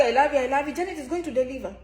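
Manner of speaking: usual speaking voice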